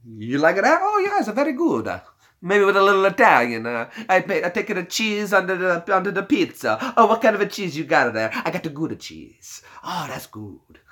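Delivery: put-on hammy, high-pitched Italian accent; broad comic Italian accent; mock Italian accent. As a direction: put on a cartoon Italian cheese-lover accent. Mock Italian cheese routine, "little Italian.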